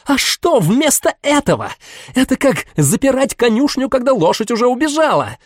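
almost shouting. Acted. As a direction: lose control and almost scream. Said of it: "почти кричала".

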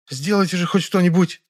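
high-pitched, agitated woman's demand. A narrator's reading. Woman appeals to the narrator to do something, demanding. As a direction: insist on help in a high voice. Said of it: "и потребовала".

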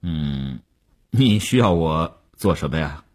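lightly voiced executive granting an audience; company boss. A narrator's reading. Tycoon answers the reporter's request.

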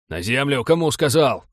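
loud, impatient bark; shouted order. A narrator's reading. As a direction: demand instant obedience. Armed man repeats his order.

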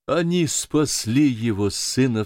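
slow, emphatic outcry; a touch of joyful awe, drawn out; exclaiming. A narrator's reading. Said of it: "вскричал Пен". (медленное восторженное восклицание)

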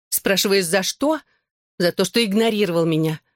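ordinary delivery

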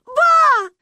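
very high, loud tearful call; crying child's wail. A narrator's reading. Manya cries for Ba over the fence, high wail. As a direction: howl for grandma. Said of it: "плакала Маня".

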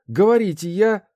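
loud, high demanding cry; shouting. A narrator's reading. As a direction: she shouts before being hushed. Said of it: "Прикрыв крикуне рот ладонью".

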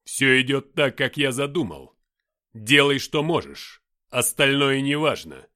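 loud, imperious mighty figure; commanding Lucifer. A narrator's reading. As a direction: boom it out slowly as absolute orders. Towering Lucifer gives orders.

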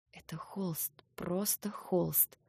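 unvoiced, slow self-reassurance; whisper. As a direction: whisper it to calm yourself. Whispering to herself. (шёпотом, себе)

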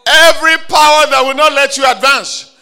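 loud, high declaration over the crowd; fervent shouted decree; full-force preaching. Acted. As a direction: preach it with rising power.

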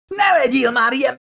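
high-pitched, desperate shriek. A narrator's reading. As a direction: shriek at a useless companion. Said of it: "sikoltott rá Milburn".

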